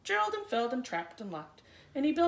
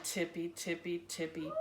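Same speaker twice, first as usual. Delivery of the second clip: sneaky; 9 semitones lower, 6 dB quieter than usual. A narrator's reading. Bunnies creeping again.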